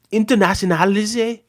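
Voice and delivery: high, loud attempt at Scouse; Jamie Carragher. Streamer practising a Scouse accent from tapes, high and loud. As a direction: have a questioning go at a Scouse accent. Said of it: "I gotta study some Jamie Carragher tapes".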